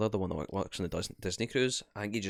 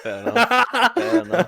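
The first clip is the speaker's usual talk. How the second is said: high, loud laughing reply; 14 dB louder than usual. Real, not acted. Listener laughing at the donut story, laughing. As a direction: laugh the words out.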